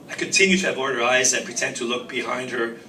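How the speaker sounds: usual speaking voice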